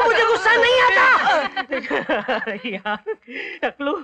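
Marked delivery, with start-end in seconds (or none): 0.1-1.0 much higher, louder yell of the same denial; angry shout. Acted. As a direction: let the anger burst out. Denial boils over. (ऊँची आवाज़ में गुस्से से चिल्लाना)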